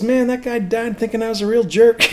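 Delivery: high, rueful Southern imitation; southern accent. Singer regretting his brush-off, imitated Southern accent. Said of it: "He's got that southern accent".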